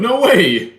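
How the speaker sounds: high, loud cry of no way; thrilled at the praise; delighted disbelief. Real, not acted. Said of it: "Your pronunciation is good".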